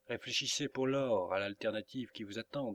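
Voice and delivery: ordinary delivery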